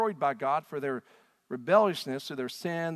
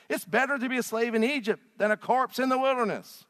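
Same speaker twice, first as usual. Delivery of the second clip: high, loud, frantic accusing cry; panicked angry complaint; 9 semitones higher, 7 dB louder than usual. Acted. Israelites at the Red Sea blaming Moses, high loud panicked outcry. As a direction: hurl it at Moses like a terrified mob. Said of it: "They said to Moses".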